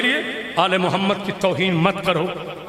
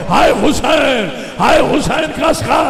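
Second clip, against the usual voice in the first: loud mourning cry; 7 semitones higher, 5 dB louder than usual. Acted. Leading mourners beating their chests, loud high wail. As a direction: call out the lament for the crowd to echo. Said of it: "एक मरतबा सीने पे मार के कहो".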